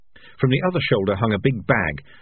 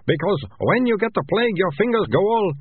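rat catcher; 12 semitones higher, 3 dB louder than usual. A narrator's reading.

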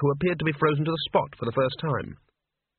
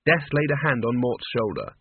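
usual speaking voice

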